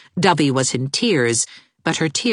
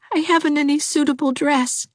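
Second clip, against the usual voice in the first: put-on much higher, weepy girl's voice; weepy young girl; tearful Dovey; 13 semitones higher than usual. A narrator's reading. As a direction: wail her misery.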